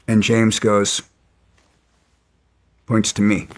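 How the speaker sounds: usual speaking voice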